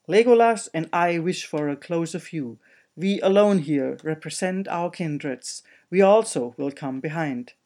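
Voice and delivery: loud, insistent dwarf; a dwarf demanding his place, loudly; gruff dwarf voice. Acted. Dwarf objects.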